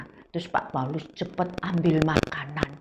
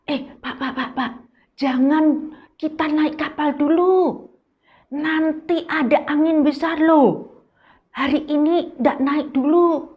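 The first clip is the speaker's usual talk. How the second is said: higher, urgent warning voice of Paul; 8 semitones higher than usual. Acted. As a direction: plead with the officer not to sail. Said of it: "Tuh dia bilang". (Paulus memperingatkan dengan suara lebih tinggi)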